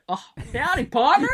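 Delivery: high-pitched, hammed-up Texan cowboy; Texas accent. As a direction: try out a Texas cowboy greeting. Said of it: "like, is that Texas?".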